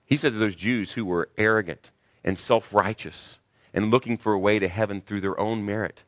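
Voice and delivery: ordinary talk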